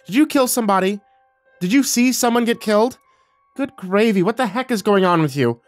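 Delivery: high, indignant mother interrogating; angry demand. Acted. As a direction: demand answers, scandalized. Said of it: "angrily cleared her throat and demanded".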